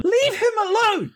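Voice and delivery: put-on very high-pitched child's cry; little girl shouting. Little girl defends someone in scene.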